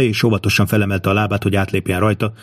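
usual speaking voice

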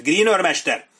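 high, loud call; shouted summons. A narrator's reading. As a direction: shout for the missing sergeant. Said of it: "kiáltotta Sharp".

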